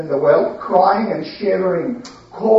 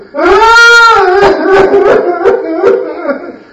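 loud high mock wail of grief; fake wailing; 11 semitones higher, 9 dB louder than usual. Brothers wailing falsely to their father at night. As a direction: wail like a brother faking sorrow. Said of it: "they started".

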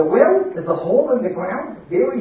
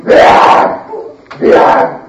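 loud unvoiced "Blah!" to scare; snake hiss-like scare noise; 8 dB louder than usual. Storyteller scaring the little boy, loud unvoiced snake blast. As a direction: blast it out like a monster in the dark. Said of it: "like this".

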